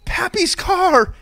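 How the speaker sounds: put-on high, drawn-out delighted kid shout; imitating an excited child. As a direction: shout it slowly like a thrilled little one. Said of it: "Lark looked over and said".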